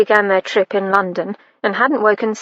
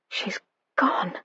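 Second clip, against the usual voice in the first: hushed, barely voiced grief; whispered; 10 dB quieter than usual. A narrator's reading. Edward whispering to his valet that she's gone. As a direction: breathe it out, stunned. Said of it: "Edward whispered".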